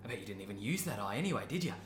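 soft cooing to an animal; soothing coo. Acted. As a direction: hush and soothe the cow. Cooing softly to cow.

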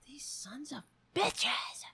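whispered high exasperated aside. Real, not acted. Owner whispering annoyed at ignoring cats. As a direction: whisper your frustration at the cats.

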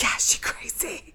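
hushed whisper in reply; whispered agreement. Real, not acted. Agreeing that Fifi is crazy, whispered. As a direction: whisper back in gossipy agreement.

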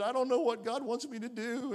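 high-pitched, mildly mock-tearful girl; retold tearful girl. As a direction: imitate a little girl fretting through tears. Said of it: "she was crying".

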